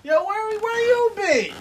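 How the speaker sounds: high-pitched mock demand; mock confronting. Acting out a wrestler to her no-show partner, mock confronting. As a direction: call out an absent partner in a high voice.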